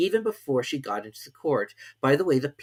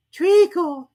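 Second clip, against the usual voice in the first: sleepy voice; 12 dB louder than usual. A narrator's reading. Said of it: "said a sleepy voice".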